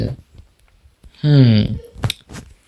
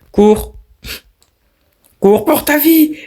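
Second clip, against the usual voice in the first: much higher, hammed-up cry to run; shrieking mock panic; 14 semitones higher than usual. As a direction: squeal it like a cartoon panic. Urging the character to flee again.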